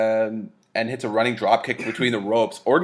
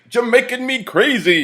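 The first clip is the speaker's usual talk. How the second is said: much higher corny voice; mock Adamle pun; 12 semitones higher, 3 dB louder than usual. Host mimics the announcer's corny pun for Kofi, higher. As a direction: deliver the pun like a cheesy host. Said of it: "he's like".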